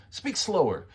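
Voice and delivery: high, pushy command; aggressive. Shown as a demonstration. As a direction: order the listener, pitched well up. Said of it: "aggressive things we don't wanna say".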